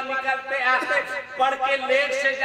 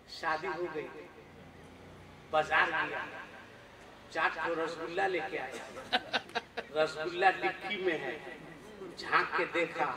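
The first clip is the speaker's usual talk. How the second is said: low, much softer, sly telling; hushed mocking aside; 9 semitones lower, 12 dB quieter, faster than usual. Preacher mocking today's son to the crowd, hushed sly aside. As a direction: murmur it like a sneaky secret.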